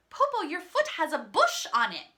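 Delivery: high, loud worried cry; alarmed child. Acted. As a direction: blurt out in alarm. Child alarmed at wolf's tail.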